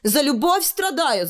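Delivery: put-on high, martyred girl's outcry; high-pitched melodramatic wail; theatrical cry. A narrator's reading. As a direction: call it out high like a suffering prisoner. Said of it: "закричала узница".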